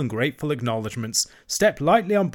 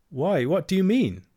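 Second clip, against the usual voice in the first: nervous; 8 semitones higher than usual. A narrator's reading. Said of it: "asked Toad nervously".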